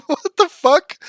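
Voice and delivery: high incredulous laugh into what the fuck; cracking up in disbelief; laughing at an insult in chat. Real, not acted.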